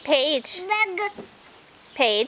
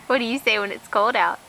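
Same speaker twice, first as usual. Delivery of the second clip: ordinary talk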